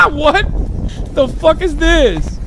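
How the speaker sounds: high-pitched incredulous laughing; laughing disbelief. Real, not acted. Streamer reacting to a bizarre confrontation clip, laughing in high disbelief. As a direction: crack up, voice shooting way up.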